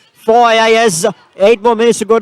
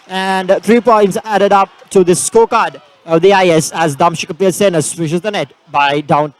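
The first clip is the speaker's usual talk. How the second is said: ordinary talk, nothing marked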